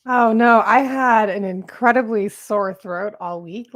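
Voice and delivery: usual speaking voice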